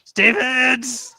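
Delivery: high, loud mock summons; calling out. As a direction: holler the name for someone away. Calls out for Steven in game.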